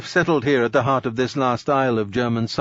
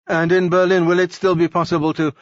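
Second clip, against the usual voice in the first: eager question; 6 semitones higher, 4 dB louder than usual. Acted. Eager officer's hopeful question.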